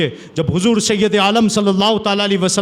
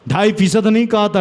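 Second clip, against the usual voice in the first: ordinary talk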